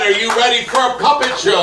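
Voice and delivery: announcer yelling to the back row. Acted. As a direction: rouse the crowd with a big shouted repeat. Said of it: "I said".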